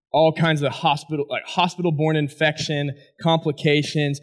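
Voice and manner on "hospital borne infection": ordinary delivery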